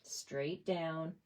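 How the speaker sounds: soft and careful, holding breath; almost under the breath while stamping; quiet, tense focus; hushed concentration. Real, not acted. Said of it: "I'm holding my breath".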